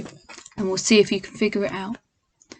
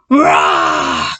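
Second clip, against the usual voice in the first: deep loud roar; lion roar; 5 dB louder than usual. Acted. Lion sees them coming, deep loud roar. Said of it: "such a loud roar".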